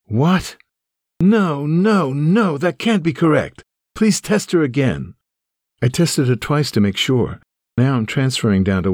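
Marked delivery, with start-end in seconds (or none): 0.1-0.6 shocked what. Retold or imitated. Hearing the leukemia diagnosis. 1.2-5.1 high, pleading disbelief that breaks; distraught denial. Retold or imitated. Son on the phone refusing his mother's diagnosis, distraught and high.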